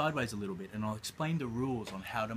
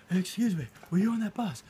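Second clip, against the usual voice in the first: desperate plea; 9 semitones higher, 8 dB louder than usual. Retold or imitated. Asking strangers, most desperate.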